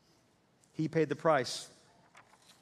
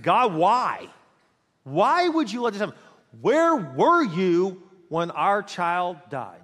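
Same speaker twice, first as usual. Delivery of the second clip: loud, raised cry of reproach at God; anguished yell; 2 semitones higher, 6 dB louder, slower than usual. Retold or imitated. Grieving father pulled over in his car, yelling at God in anguish. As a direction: yell it out in grief and anger, dragging out the last question. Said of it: "I just yelled at God".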